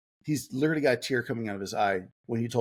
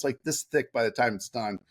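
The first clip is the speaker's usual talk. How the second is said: ordinary talk, nothing marked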